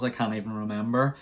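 normal talking voice

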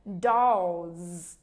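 "Dolls" with long o, dark L and buzzing z; long o, z ending. Shown as a demonstration. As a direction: end "Dolls" on a clear z sound. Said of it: "Vẫn kéo dài âm O và vẫn ồ âm L".

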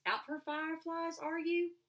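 a light, high, airy stranger's voice; a stranger chatting to a girl at the gate, light and high. A narrator's reading. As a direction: keep it light and breezy. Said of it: "he said in a light voice".

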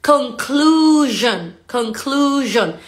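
"conclusion" drawn out with a voiced zh; buzzing S. Shown as a demonstration. Teacher modelling the word for learners, S vibrated. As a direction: stretch "conclusion" slowly, vibrating the s. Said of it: "la S tiene un poquito de vibración".

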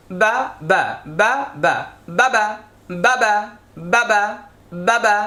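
"বা, বা" in brisk pairs with brief vowels; quick short syllables. Shown as a demonstration. Teacher drilling the vowel sign, "বা" said quickly. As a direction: fire off "বাবা" with no stretch. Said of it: "তখন আমরা তাড়াতাড়ি বলছি".

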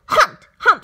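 high, loud burst; sudden shout. A narrator's reading. Kangaroo breaking in on Horton, sudden high shout. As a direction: break in with a high, punched call.

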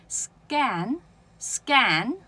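scan, drawn out without a puff; unaspirated k. Shown as a demonstration. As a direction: scan twice as "sgan", slow and spaced. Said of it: "都念一下哦".

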